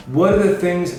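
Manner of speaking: ordinary delivery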